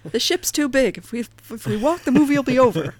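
movie quote voice. Retold or imitated. Spaceballs quote acted out.

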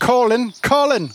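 "Colin" high and falling, in mock Irish; Irish pronunciation. Shown as a demonstration. Game master correcting a player, "Colin" in fake Irish accent. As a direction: say "Colin" with a put-on Irish lilt. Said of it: "Not Colin".

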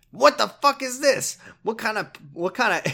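high, loud angry yell; pissed off man. Retold or imitated. A pissed off man on the street yelling angrily. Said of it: "a pissed off man".

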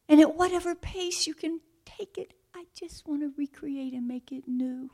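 high, tender voice fading to a whisper; God's gentle voice rising high then whispered. Acted. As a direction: speak as a loving God, softening to a whisper. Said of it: "at the very beginning he says".

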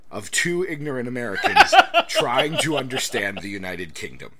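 laughing midway through the line. Real, not acted. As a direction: crack up while saying it.

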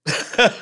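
a quick burst of high laughter; short high laugh. Real, not acted. Streamer laughing at the rant he just played. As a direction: laugh in surprise at what you just heard.

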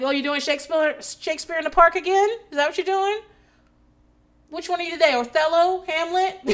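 high-pitched mock-curious questions; sarcastic teasing. Parent teasing a nine-year-old about Shakespeare, sarcastic. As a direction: needle them with fake-sweet interest. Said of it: "I'm very sarcastic with my kids".

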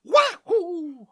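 high shouted cartoon cheer; Mario voice. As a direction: jump and yell as Mario. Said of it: "the jump and then a wall bounce".

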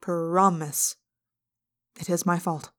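ordinary delivery, nothing marked

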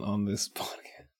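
whispered through a laugh. Real, not acted. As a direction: giggle it under your breath.

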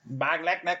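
mock Norwegian gibberish on request; fake Norwegian. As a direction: blurt a made-up Norwegian word. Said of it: "do your best Norwegian accent".

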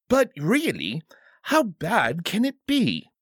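high, coaxing royal plea; pleading. A narrator's reading. King begging his bodyguard to relent, pleading. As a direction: plead to be allowed, pitch swooping up. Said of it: "the king pleaded".

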